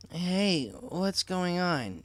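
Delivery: 1 just woken. Acted. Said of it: "her sleep suddenly disturbed".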